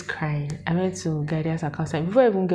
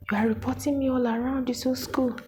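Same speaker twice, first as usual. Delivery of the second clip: accusing lecturer; 8 semitones higher than usual. Retold or imitated. Repeated accusation.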